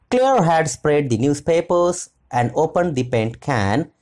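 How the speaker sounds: plain reading voice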